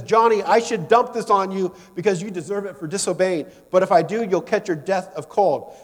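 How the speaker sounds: acted exasperated mom rant; mad mother. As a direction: tell off a child as an irate mom. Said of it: "And Mom is mad".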